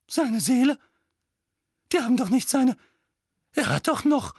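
high loud frightened cry; mother panics about her son's soul; panicked mother. A narrator's reading. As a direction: fret wildly for her son. Petunia frightened.

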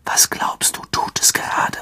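hushed, voiceless question; whisper. A narrator's reading. Harry to Ron as the forest falls silent, whispered. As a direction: whisper it warily. Harry asks, hiding from creature.

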